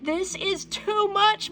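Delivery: protest. A narrator's reading. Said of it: "my mom protested".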